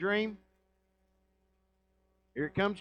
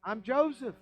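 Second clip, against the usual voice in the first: higher-pitched cry of revelation, lightly acted; retold tearful outburst; 8 semitones higher than usual. Joseph revealing himself to his brothers, mock tearful outburst. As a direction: blurt it out high, mildly overcome. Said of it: "He starts crying, and he says".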